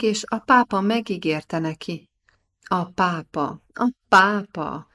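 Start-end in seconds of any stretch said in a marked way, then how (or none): 3.7-4.9 lassan, hangosan, gúnyosan felkiáltva; csodálkozó, lassú felkiáltás. A narrator's reading. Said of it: "kiáltotta a kurátor felesége". (a kurátor felesége gúnyosan felkiált, lassan és hangosan)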